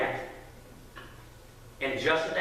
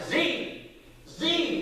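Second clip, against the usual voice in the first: high mock scream, held back in volume; imitated yelling; 10 semitones higher than usual. Dad calls his sleeping friend repeatedly, mock yell. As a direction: call the name high, twice over. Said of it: "I screamed out the third time".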